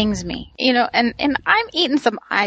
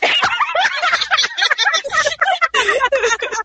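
high shrieking laugh. Real, not acted. Reacting to the shocking news.

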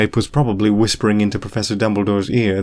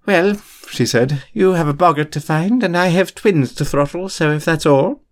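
higher, brisk dismissive teacher voice; brisk McGonagall; 7 semitones higher than usual. A narrator's reading. McGonagall dismissing Remus, brisk higher voice. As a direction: wrap it up crisply.

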